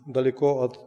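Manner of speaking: ordinary talk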